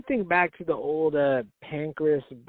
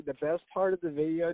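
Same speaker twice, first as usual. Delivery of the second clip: normal talking voice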